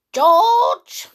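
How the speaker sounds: high, loud shrill call from next room; shrill Grandma call. A narrator's reading. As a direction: shout his name shrilly. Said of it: "came the shrill voice".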